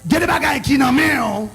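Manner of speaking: loud, shouted sermon delivery; full-force preaching. Real, not acted. Preacher shouting to the crowd about God's work, full-force preaching. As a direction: drive it home to the congregation at a shout.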